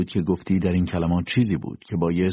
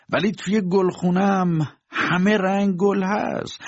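said aloud; 10 semitones higher than usual. A narrator's reading. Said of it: "بعد با صدای بلند گفت".

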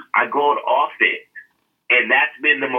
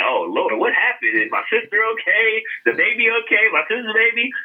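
panicked questions; 8 semitones higher than usual. Retold or imitated. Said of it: "I'm like".

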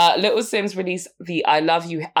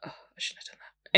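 whispered to yourself; hushed aside after a bad listen; regretful whisper; 9 dB quieter than usual. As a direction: breathe it like a quiet regret. Regretting clicking a rapper's link.

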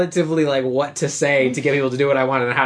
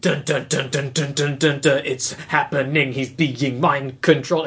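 drawn-out, plodding slow-motion narration; mock slow motion. Mimicking a movie kiss in slow-mo, drawn-out and plodding. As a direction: chant it in heavy slow-mo beats. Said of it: "it's like a slow-mo".

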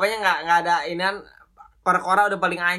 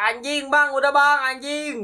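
mild mock yell, higher and drawn out; half-yelled retold plea, pitched up; 6 semitones higher than usual. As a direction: imitate yourself begging to get off a scary ride. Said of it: "Teriak, gua jadi teriak-teriak".

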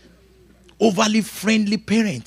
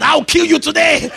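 mock child threat; 9 semitones higher than usual. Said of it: "One child is sitting on another child".

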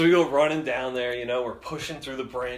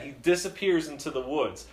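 usual speaking voice

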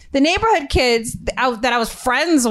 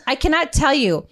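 normal talking voice

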